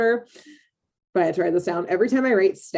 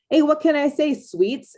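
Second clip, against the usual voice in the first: put-on New York accent; 8 semitones higher than usual. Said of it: "he goes".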